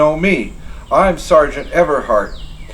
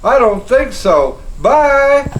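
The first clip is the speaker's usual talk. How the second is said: cheeky taunt; 12 semitones higher, slower than usual. Acted. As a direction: toss it back gleefully. Sergeant taunting as he leaves.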